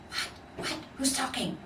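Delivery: surprised voice waking up; wakened ornament asking who's there; startled hushed voice. Acted.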